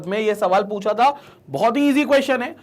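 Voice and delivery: normal talking voice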